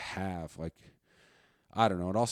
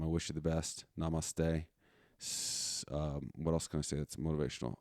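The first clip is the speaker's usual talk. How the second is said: ordinary talk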